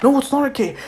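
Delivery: high, flustered, stammering pleading; guilty panic. Acted. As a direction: plead frantically to make amends.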